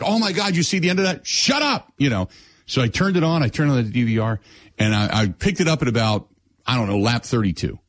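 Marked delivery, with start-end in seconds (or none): 0.0-1.9 excited retelling. Retold or imitated. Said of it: "everybody was like".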